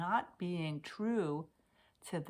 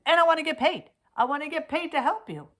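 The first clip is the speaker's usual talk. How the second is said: emphatic declaration; 10 semitones higher, 11 dB louder, faster than usual. Real, not acted. Declaring she wants to get paid.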